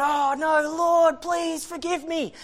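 high, loud pleading prayer; crying out. Retold or imitated. Preacher begging God for forgiveness, high crying out. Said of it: "I'm crying out".

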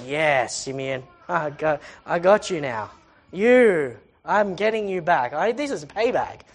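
low, mock-vengeful muttering; imagined payback. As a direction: play at savouring revenge on a big brother. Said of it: "I reckon he went".

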